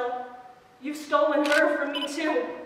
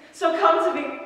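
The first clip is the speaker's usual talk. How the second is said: loud command; 5 semitones higher, 8 dB louder than usual. Acted. Commanding lure in monologue.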